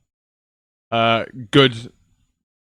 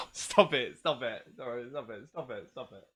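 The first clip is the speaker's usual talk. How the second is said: fading away; 3 semitones lower, 14 dB quieter than usual. Real, not acted. Streamer bashfully brushing off chat's praise, fading to very quiet. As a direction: protest dwindling to barely audible. Playful protest trailing into near silence.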